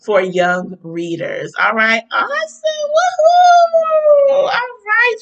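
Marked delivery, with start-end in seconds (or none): 2.1-5.2 high-pitched, whooping celebration; excited cheer. Real, not acted. Host celebrating a young readers' author, excited cheer.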